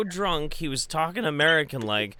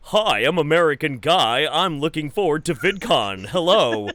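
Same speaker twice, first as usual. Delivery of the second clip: loud, stiff mock American greeting; imitated American accent; 7 dB louder than usual. Retelling a friend's loud mock American accent. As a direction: play a British guy faking American. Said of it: "do an American accent".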